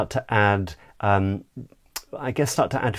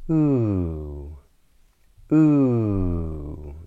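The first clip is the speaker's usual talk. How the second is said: long "ooh" sliding downward in pitch; falling glide; 3 dB louder than usual. Shown as a demonstration. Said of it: "the pitch of the voice drops down".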